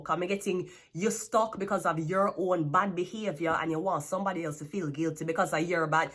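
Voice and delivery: usual speaking voice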